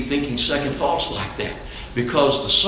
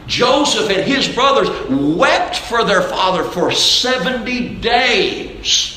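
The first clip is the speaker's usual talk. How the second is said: higher, insistent sermon voice citing scripture; urgent pulpit proclamation, driving from proof to proof; forceful preaching; 8 semitones higher, 4 dB louder than usual. Real, not acted. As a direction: preach it hard, leaning on key words.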